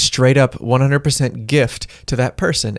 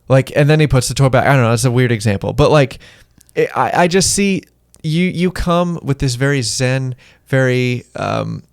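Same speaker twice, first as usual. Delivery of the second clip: ordinary talk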